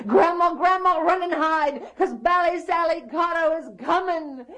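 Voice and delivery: high, loud warning shout; boy's high-pitched panicked yell; yelling and hollering. Acted. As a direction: holler an urgent alarm to Grandma. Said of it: "yelling and hollering".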